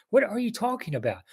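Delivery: high-pitched, puzzled question; mock bafflement. Acting his baffled reply to Fred.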